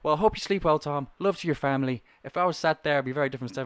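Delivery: threatening Irish customer impression; Irish caller. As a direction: sign off with a veiled threat. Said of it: "At the end, he goes".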